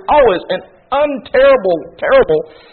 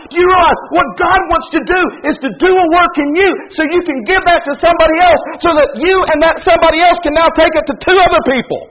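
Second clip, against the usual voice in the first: higher, shouted pulpit cry; preaching shout; 8 semitones higher than usual. Acted. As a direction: proclaim it to the back row. Preaching at full force.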